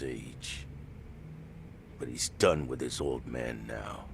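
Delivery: low, weary admission; subdued confession. Acted. Beaten fighter tells Kaito he is quitting, subdued. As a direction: let it sink low and regretful.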